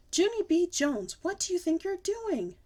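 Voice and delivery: high, loud scolding mother; mother's furious yell; angry yell. Acted. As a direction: shout at the child. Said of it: "yelled an angry voice".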